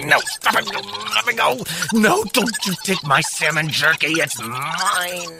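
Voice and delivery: high hammed-up protest; mock struggle. Host pretending raccoons take his salmon jerky, mock panic. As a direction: fight them off comically.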